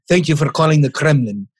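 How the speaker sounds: tries a mock Russian accent on "Thank you for calling the Kremlin". Cohost asks if his Russian accent works. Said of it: "Am I good enough?".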